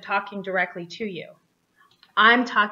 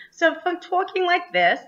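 higher Rochester accent put on; 8 semitones higher, 3 dB louder than usual. Shown as a demonstration. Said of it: "then that's impersonation".

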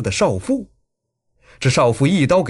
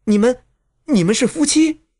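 high, halting, stunned question; falter, then ask in high-pitched disbelief; stammering shock; 10 semitones higher than usual. A narrator's reading. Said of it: "结结巴巴地问道".

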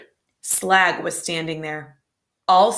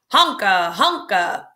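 honking call; 2 semitones higher, 4 dB louder, slower than usual. Acted. Clown honking at a show.

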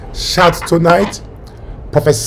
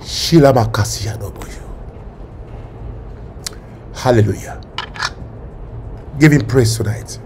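much lower, softer worship murmur; hushed praise; 7 semitones lower, 9 dB quieter than usual. Acted. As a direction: hush into quiet worship. Quiet after climax.

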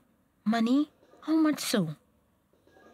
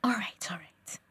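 whisper; 4 semitones lower, 4 dB quieter than usual. Acted. Giving in, whispered.

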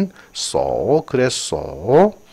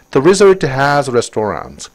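very slow, clear English read; slow textbook reading; slow reading aloud; 3 semitones higher, 4 dB louder, much slower than usual. Shown as a demonstration. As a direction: read it out word by word for the class. Reads English sentence aloud.

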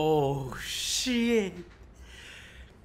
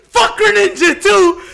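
high, loud yells broken by laughter; laughing shouts; 9 dB louder than usual. Real, not acted. Hype reaction, characters disappearing.

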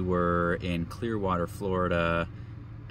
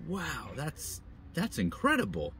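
amazed wonder; 7 semitones higher, 4 dB quieter than usual. Retold or imitated. Stranger's wife voiced amazed.

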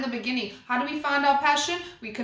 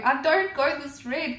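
ordinary talk

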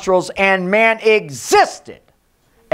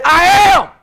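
higher, louder, slow command; 6 semitones higher, 3 dB louder, slower than usual. Shown as a demonstration. Preacher voicing God's command, loud and slow. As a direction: proclaim it like God's own command. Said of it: "He said".

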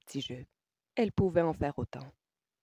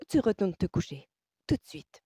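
firm decree; 3 semitones higher, 5 dB louder than usual. A narrator's reading. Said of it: "décréta Francesca".

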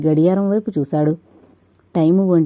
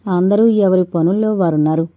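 plain reading voice

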